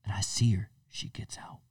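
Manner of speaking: whispered, secretive retelling; hushed spy whisper. Retold or imitated. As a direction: whisper like you're still hiding. Retelling spying on neighbor.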